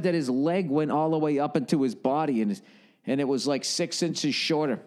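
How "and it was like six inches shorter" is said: normal talking voice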